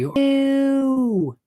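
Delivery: long, very high falling sound; imitated swooping noise. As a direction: hold a high note, then slide down. Sudden noise imitated in the story.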